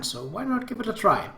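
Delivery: ordinary delivery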